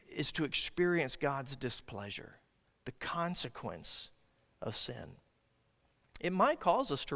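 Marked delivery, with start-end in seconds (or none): 0.1-5.2 hushed warning. Acted. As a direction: drop to a whisper for God's displeasure. Whispered warning in sermon.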